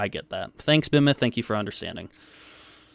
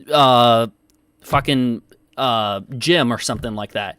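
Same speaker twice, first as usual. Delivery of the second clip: ordinary talk, nothing marked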